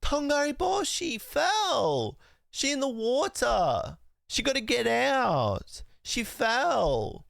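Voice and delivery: put-on high, slow little-kid voice retelling the scene; slow, high toddler commentary; imitating toddler. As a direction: narrate it the way a toddler explains a cartoon, slowly. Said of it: "he was like".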